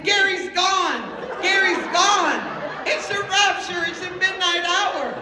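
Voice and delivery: loud, high, wailed panic; hysterical hollering. Retold or imitated. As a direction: holler the alarming news in mock hysteria. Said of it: "a hundred percent hysteria".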